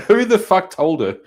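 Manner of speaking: high, loud disbelieving yell; real exasperated shout; incredulous outburst. Real, not acted. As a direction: blurt it out in shock. Outraged reaction to clip.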